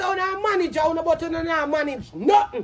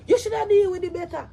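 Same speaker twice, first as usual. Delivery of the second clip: ordinary talk, nothing marked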